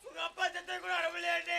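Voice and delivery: high, soft tearful whine; mock crying. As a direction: sob softly in fake mourning. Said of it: "இப்ப நீ ஏன் அழுகுற?". (மெல்லப் போலியாக அழுதபடி)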